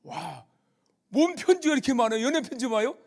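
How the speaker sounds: loud, high imitation of an astonished reporter; curious reporter. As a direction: blurt out nosy surprise as the reporter. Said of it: "기자가 또 보았네요".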